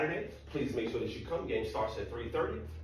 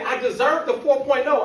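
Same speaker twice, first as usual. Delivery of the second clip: put-on higher, louder indignant girlfriend; girlfriend's voice; 14 semitones higher, 13 dB louder than usual. Girlfriend demanding her four point oh, higher indignant voice. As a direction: insist like she's been robbed. Girlfriend insists on perfect grade.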